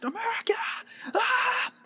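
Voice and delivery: high hammed-up cheer; high cheering yell; mock cheering fan. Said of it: "It's like".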